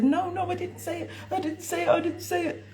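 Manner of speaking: put-on high, whiny little-girl denial; mimicking little girl. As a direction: squeak it like a guilty child.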